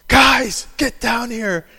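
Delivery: loud, high call of a raging father; mock father shouting the kids downstairs; dad's angry yell. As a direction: bellow it like a furious dad. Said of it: "And his rage would go".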